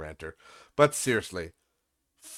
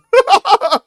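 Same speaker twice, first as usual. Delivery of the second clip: loud high laugh; 12 dB louder than usual. Real, not acted. Laughing at a fake username, loud burst.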